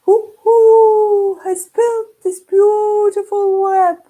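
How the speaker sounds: high, loud, slow hooting owl. Acted. Night owl calling to the spider, high and hooting. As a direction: hoot the words out long and high. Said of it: "The owl said".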